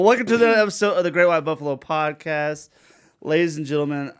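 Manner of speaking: loud announcer-style welcome; podcast host intro. Acted. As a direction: sell it like a show host.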